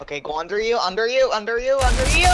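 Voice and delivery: urgent callout. Real, not acted. Player directing teammate to go under, increasingly urgent.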